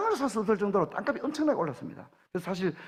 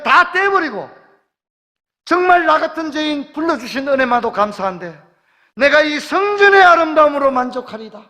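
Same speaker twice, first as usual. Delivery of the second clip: higher and louder, fervent exhortation; full-force preaching; 6 semitones higher, 5 dB louder than usual. Acted. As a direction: lift the congregation with rising fervor.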